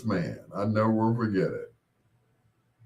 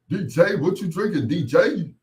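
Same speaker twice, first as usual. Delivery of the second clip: hammed-up stress on D J; mocking emphasis on D J; 6 semitones higher than usual. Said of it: "they have to emphasize on the D J".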